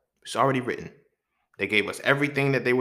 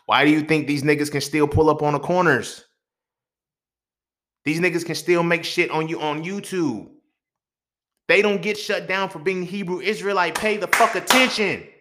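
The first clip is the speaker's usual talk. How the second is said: real frustrated shouting, higher and louder; frustrated rant; 6 semitones higher, 8 dB louder than usual. Real, not acted. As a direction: blow up at the audience in real anger. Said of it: "I'm getting too frustrated".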